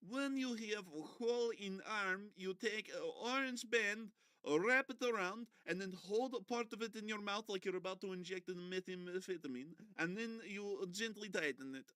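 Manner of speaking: high, hammed-up instructional narrator voice; mock instruction voice. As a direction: read it like a silly training video. Reading manual mockingly in character voice.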